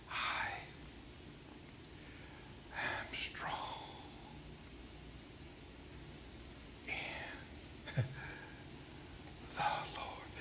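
faint whisper, long gaps between words; barely audible, painfully slow; weak, struggling whisper. Retold or imitated. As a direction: imitate a bedridden woman labouring through each word. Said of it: "took a while".

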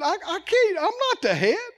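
hammed-up tearful whine; mock crying. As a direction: whimper out the refusal like a scared worker. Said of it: "what if Rob kept crying".

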